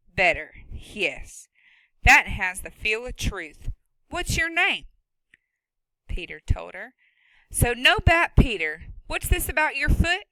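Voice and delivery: loud, sharp no-nonsense woman; stern farm woman. Acted. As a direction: scold him from her own barn. Woman character voice.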